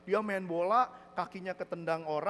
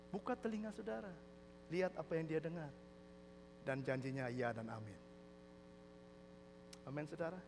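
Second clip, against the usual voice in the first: hushed preaching; 7 dB quieter than usual. Real, not acted. Preacher applying the joke to congregation, hushed. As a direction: drop soft and bring the lesson home. Soft exhortation after joke.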